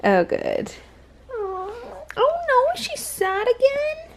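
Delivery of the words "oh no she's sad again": much higher mock pity. Mom pitying her toddler in a high coo. Mom reacting to baby's sadness.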